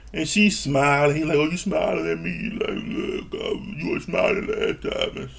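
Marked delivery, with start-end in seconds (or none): 1.4-5.2 hushed, voiceless imitation of him; mock his mumbling in a near-whisper. Mocking his reply to her smile.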